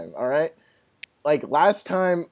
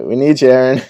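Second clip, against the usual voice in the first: louder, playful call to a friend; mock plea; 3 semitones lower, 7 dB louder, faster than usual. Host begging absent buddy onto podcast, loud mock plea.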